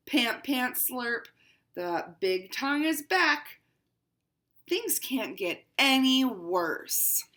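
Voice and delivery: picture book read as a proud cat; lightly voiced haughty cat; cat narrator, read aloud. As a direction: storytime reading to children.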